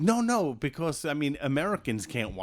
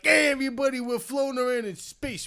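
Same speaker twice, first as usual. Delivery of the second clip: casual American dude; 11 semitones higher, 5 dB louder than usual. Retold or imitated. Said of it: "We can't be like".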